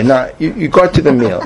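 ordinary talk

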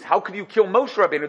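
normal talking voice

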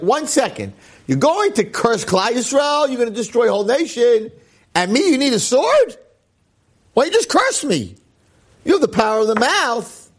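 high, mocking talking-donkey voice; donkey's rebuke. Donkey scolding Bilam, high mocking voice.

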